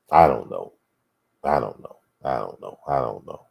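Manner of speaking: low, subdued resignation; real, downcast and trailing away; somber. Real, not acted. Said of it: "somber, wasn't it".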